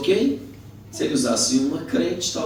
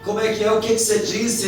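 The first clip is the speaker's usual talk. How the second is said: raised, louder challenge; confrontational demand; 8 semitones higher, 4 dB louder, faster than usual. Retold or imitated. Friend confronting the drunk, demanding. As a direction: demand that he repeat it.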